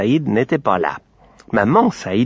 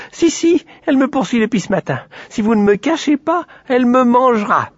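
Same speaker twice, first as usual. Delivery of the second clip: a scared little pig squeals for help; frightened piglet; 13 semitones higher than usual. A narrator's reading. Piglet tells the girls Aurore wants to eat him, high and frightened.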